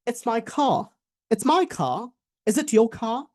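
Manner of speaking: "car" as British "cah", R dropped; British-style, no R at the word's end; British accent. Shown as a demonstration. Teacher shows how UK speakers say it, British accent. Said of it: "But ওরা বলবে".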